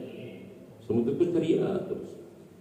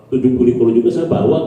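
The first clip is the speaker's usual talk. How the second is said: eager boast; 4 semitones lower, 10 dB louder, faster than usual. Retold or imitated. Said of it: "kayaknya gitu loh ya".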